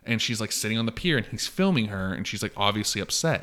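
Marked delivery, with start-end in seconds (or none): none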